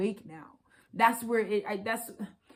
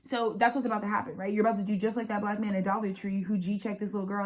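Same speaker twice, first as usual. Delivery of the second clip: ordinary delivery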